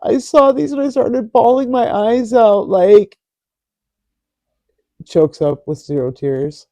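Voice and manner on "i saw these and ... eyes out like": higher, louder mock whimpering. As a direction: whine it like a fake crier. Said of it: "Back to whimper voice".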